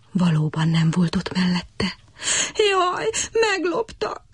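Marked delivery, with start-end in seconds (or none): 2.7-4.2 high, slow, whispered lament; bitter wailing. A narrator's reading. Cricket wails bitterly over the stolen violin. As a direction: wail that it's stolen. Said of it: "siránkozott keservesen".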